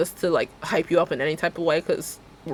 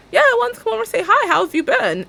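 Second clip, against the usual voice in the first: high, bright and fast; chirpy stranger; 5 dB louder than usual. Retold or imitated. Client's mom greeting the stylist, high and chirpy. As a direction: chatter like an overly friendly stranger. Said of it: "And she was like".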